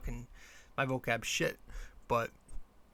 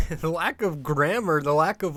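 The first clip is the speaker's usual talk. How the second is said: laughing emphasis; 3 semitones higher, 6 dB louder than usual. Real, not acted. Laughs while repeating phrase.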